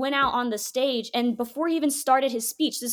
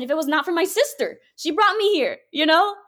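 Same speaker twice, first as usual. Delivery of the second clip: normal talking voice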